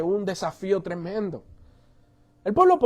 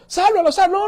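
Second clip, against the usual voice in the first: loud, high shout to save him; acted crew shouting out; urgent rescue call; 11 semitones higher, 5 dB louder than usual. Boat crew spots the drowning man, shouting urgently.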